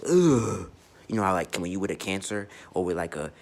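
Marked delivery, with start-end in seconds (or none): 0.1-0.7 overwhelmed groan. Retold or imitated. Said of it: "where I'm like".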